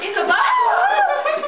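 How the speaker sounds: high shout dissolving into laughter; belting it out through giggles; laughing shout. Real, not acted. Stuck in a shirt with her friend, laughing shout.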